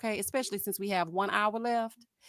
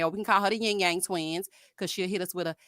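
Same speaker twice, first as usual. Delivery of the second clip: ordinary talk, nothing marked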